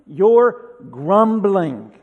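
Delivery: "grumbling" hit loud and drawn out slowly; stress on grumbling. Shown as a demonstration. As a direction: lean hard and slow on "grumbling". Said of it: "Emphasis on that word".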